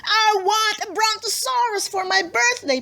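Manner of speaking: put-on high shrill demanding girl voice; screeching child. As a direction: whine it way up high like a spoiled kid. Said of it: "she said in between screeches".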